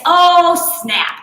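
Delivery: high, loud startled yelp; surprised exclamation. Real, not acted. Instructor yelping as the hip dips begin. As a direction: blurt it out, caught off guard.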